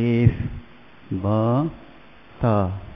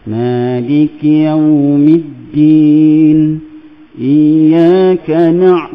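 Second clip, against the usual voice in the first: Quran recitation; 4 dB louder, slower than usual. Shown as a demonstration. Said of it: "Tiba-tiba kita naik".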